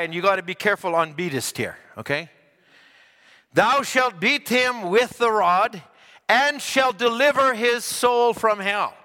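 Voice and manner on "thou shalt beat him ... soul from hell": slow, emphatic verse reading; scripture reading. Acted. Preacher reading Proverbs to the congregation, slow and solemn. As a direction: intone each phrase slowly.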